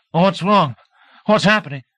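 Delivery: jolted awake and confused; startled waking. A narrator's reading. Groggy deputy woken by barking, startled and confused.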